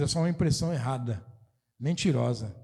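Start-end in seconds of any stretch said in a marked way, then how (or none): none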